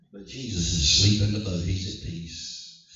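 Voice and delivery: very low, settled voice; deep calm. Real, not acted. Preacher shows Jesus asleep amid the storm, deeply calm. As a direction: drop right down low, slowing to a hush. Contrasts sleeping Jesus with panic.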